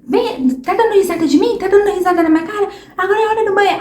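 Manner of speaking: high, fast, exasperated scolding; mock the impatient adult losing it; irritated parent. Parent losing patience with a laughing child at bath time, high exasperated scolding. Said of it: "o adulto não entende, acha que".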